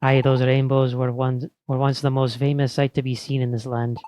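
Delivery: hammed-up, badly faked Scots; bad Scottish accent. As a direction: play a villager in a shaky attempt at Scottish. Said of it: "This is a terrible accent".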